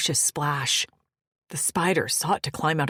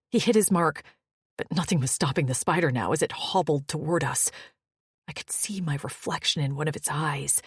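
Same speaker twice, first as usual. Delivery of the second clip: ordinary delivery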